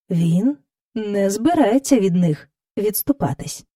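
usual speaking voice

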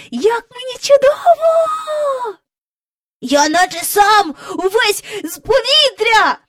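high, loud and slow, full of wonder; joyful shouting. A narrator's reading. Michael shouting with joy while flying. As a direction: call out in pure delight. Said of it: "гукав Майкл".

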